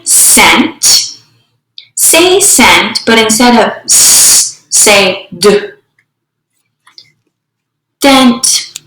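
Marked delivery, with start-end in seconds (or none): 3.8-4.6 "s" as a held hiss; just the consonant "s", not "ess"; isolated phoneme. Shown as a demonstration. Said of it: "substituting phonemes". 5.4-5.7 "d" as a short "duh"; just the stop sound "d"; isolated phoneme. Shown as a demonstration. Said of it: "substituting phonemes".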